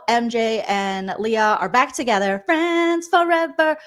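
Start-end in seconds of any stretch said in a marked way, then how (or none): 2.4-3.8 high, stretched-out singsong; mock gushing. Recapper describing housemates back together, mock gushing. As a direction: draw it out sweetly and much higher. Mocking gushy reunion.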